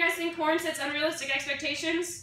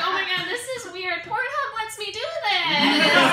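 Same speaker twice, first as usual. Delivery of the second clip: mock bewildered; 6 semitones higher, slower than usual. Her confused reply.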